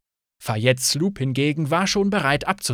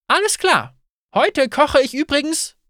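much higher, louder breezy call; announcing; 12 semitones higher, 5 dB louder than usual. Acted. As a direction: brush it off and announce dinner. Innkeeper announces to guests.

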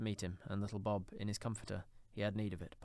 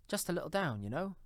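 higher, lilting aside as Bob; 7 semitones higher, 3 dB louder than usual. A narrator's reading. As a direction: slip in a modest aside, pitched up. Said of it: "said Bob".

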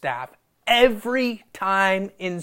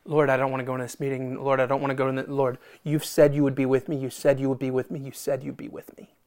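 low, soft, hurried pleading under the breath; cling nervously to a promise, repeating it quietly; anxious muttered prayer; 4 semitones lower, 5 dB quieter, faster than usual. Acted. Believer praying before a dreaded meeting, low anxious muttering.

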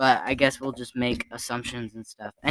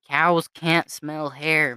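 slow deadpan repeat; much slower than usual. Retold or imitated. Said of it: "This was definitely me".

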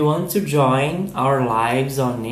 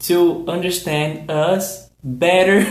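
ordinary talk

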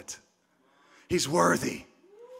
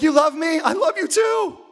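mock delighted; 6 semitones higher than usual. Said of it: "You're like".

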